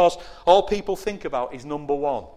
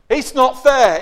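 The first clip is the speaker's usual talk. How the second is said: whiny excuse; 8 semitones higher, 9 dB louder, slower than usual. Acted. Mimicking excuse-maker.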